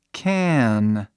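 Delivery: "Can" in English, long, tongue closing on N; English final N. Shown as a demonstration. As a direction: lengthen "Can" into a clear final N. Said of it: "Veja a diferença".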